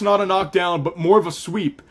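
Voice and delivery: ordinary talk, nothing marked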